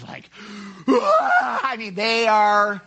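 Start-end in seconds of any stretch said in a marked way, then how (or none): 0.9-1.6 loud, high scream from the depths of the throat; acted full-throated shriek. Shown as a demonstration. Preacher demonstrates the Greek word for crying out, throat scream. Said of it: "to scream from the depths of your throat".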